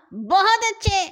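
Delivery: high, loud call of praise; loud cheer. A narrator's reading. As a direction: cheer the fight on loudly. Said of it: "حسن نے زور سے کہا". (زور سے داد دینا)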